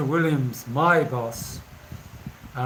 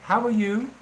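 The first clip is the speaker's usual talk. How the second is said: imitating kids, pitched up with a rising question; kids' greeting; 7 semitones higher, 3 dB louder than usual. Kids coming up to greet a foreigner, imitated higher greeting. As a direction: lightly mimic a chirpy higher child greeting. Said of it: "you get kids coming up".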